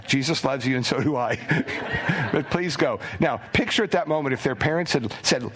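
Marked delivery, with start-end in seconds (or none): 0.1-3.0 laughing plea. Retold or imitated. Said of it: "just saying".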